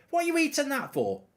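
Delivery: high-pitched mimicry of pet scolding; mocking the dog owner. Mocking how the owner blamed the dog, high-pitched scolding imitation. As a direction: put on a high, fussy scold at a lowish volume. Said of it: "It's not the bloody dog's fault".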